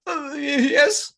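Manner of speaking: very high, bleating sheep; squeaky falsetto sheep. Acted. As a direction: stammer a timid answer as a sheep. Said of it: "Sheep looks up at you".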